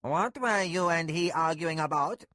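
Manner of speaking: loud, high, demanding question; demanding steward. A narrator's reading. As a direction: demand an answer.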